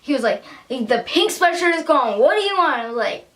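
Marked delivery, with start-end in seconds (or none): 0.7-2.8 high, curt mock impatience; imitated rude vendor. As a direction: snap it like a fed-up merch seller. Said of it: "not the nicest to me either".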